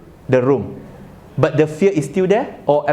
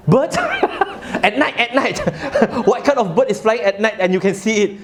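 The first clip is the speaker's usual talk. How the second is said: incredulous question through laughter; laughing; 5 semitones higher than usual. Real, not acted. Speaker jokes with the audience about a night bird, laughing. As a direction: laugh while you ask, pitched higher.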